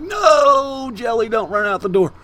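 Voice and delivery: high, loud mock-desperate cry; anguished call. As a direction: cry out after a runaway pet, hammed up. Retold cry to a fleeing cat.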